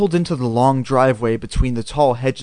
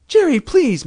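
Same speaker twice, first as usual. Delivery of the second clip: high, alarmed mom's plea; desperate, pitched-up begging; pleading; 13 semitones higher, 4 dB louder than usual. A narrator's reading. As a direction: plead urgently with a screaming child. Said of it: "Mom pleaded".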